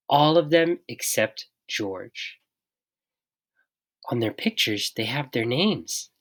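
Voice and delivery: usual speaking voice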